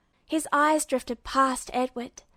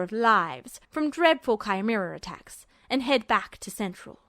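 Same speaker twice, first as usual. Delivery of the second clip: lightly voiced cold officer, slowing at the end; understated menace, slowing on the last words; menacing officer; 3 semitones lower than usual. A narrator's reading. Villain voices his threat.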